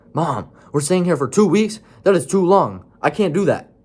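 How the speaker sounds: put-on high, loud teen complaint; outraged daughter yelling. A narrator's reading. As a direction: yell it at mom in protest. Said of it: "She was now yelling at her mom".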